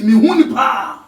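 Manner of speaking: high, loud declaration; emphatic shout. Acted. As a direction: proclaim it at full volume.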